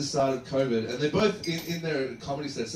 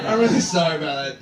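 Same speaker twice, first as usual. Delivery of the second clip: laughing apology; 3 semitones higher, 8 dB louder than usual. Real, not acted. Mock apology after roast joke.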